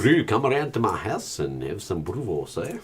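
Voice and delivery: put-on a mild South African accent inviting a friend round. Mocking a South African mate, light South African accent. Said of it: "I can actually do a pretty good South African accent".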